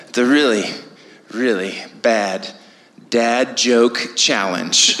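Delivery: low, slow dramatic game-show title; mock announcer. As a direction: drag it out like a movie trailer voice.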